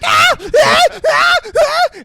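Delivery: very high, loud terrified shrieks; panicked screams. Retold or imitated. Honey Bunny screaming again.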